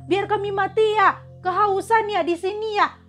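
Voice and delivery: hammed-up accusation hurled at Moses; angry accusing crowd. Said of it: "mereka bilang apa sama Musa".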